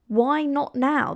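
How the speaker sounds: ordinary talk, nothing marked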